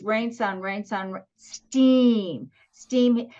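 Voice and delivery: drawn-out complaint. Real, not acted. Complaining about the summer weather.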